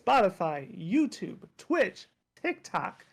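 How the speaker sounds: ordinary talk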